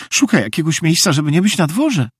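high, quick fretful whine; whining. A narrator's reading. Meg explains why the tramp prowls, whining. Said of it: "zajęczała Meg".

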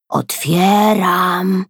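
drawn-out, very slow word; slow and low. A narrator's reading. Boy slowly announces he is opening the door, drawn out. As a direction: stretch it out for suspense. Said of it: "powiedział Kajtek powoli, niskim głosem".

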